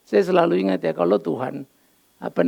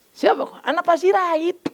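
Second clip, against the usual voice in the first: high, lightly voiced doorman; doorman's voice; 10 semitones higher than usual. Doorman letting the boy in, lightly voiced. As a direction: mimic the cinema doorman, pitched up.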